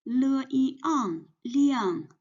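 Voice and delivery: le, yi, ang, then liang blended together; sounding out pinyin. Shown as a demonstration. Teacher spelling liang for students, slow and clear. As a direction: spell out the parts of liang, then join them. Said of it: "and one compound final ang".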